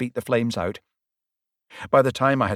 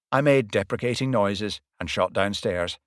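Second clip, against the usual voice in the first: plain reading voice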